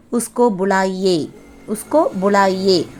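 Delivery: உஸ்கோ புலாயே as "us-ko bu-laa-ye"; Hindi pronunciation. Shown as a demonstration. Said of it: "இது எப்படி ஹிந்தியில pronounce பண்ணலாம்". (உஸ்கோ புலாயே, இந்தி உச்சரிப்பில் "உஸ்கோ bu-லாயே")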